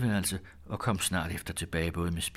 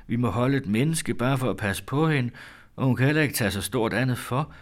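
louder, higher, distressed lament; tearful outburst; 6 semitones higher, 6 dB louder than usual. A narrator's reading. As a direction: pour out the trouble, pitched up and pressing. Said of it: "sagde hun bristende i gråd". (grådkvalt klage)

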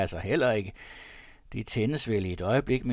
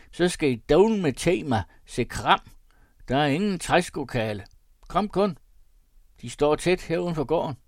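self-satisfied squire, higher and louder; smug rich landowner; 6 semitones higher, 5 dB louder than usual. A narrator's reading. Landowner showing off his oxen to guests, smug. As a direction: beam with pride over his cattle.

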